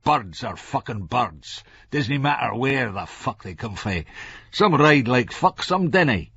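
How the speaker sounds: understated irritated Scots retort; snapped. A narrator's reading. Franco lays down his view, mildly snappish. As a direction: argue with a touch of temper. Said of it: "Franco snapped".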